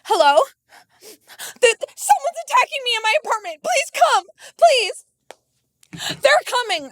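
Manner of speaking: high, pleading mock phone call; hammed-up frightened call; mock panicked call. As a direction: beg the police in her high voice. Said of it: "she was like".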